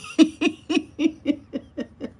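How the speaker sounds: breaking up in high giggles; long run of high, real laughter. Real, not acted. Woman scoffing at claimed jealousy of lifestyle, high laughter.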